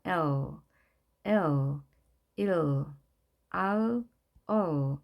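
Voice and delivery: "L, 일, 얼" each ending in a dark English L, lips spread sideways; drawn-out "L, 일, 얼" gliding into a final L; L with lips stretched. Shown as a demonstration. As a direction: end "L" and "얼" with lips stretched long to the sides. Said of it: "입술이 옆으로 길게 늘려진 상태에서 끝이 나야".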